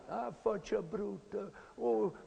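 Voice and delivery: a typical Italian mother swearing under her breath; Italian mother cursing. Retold or imitated. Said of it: "like this".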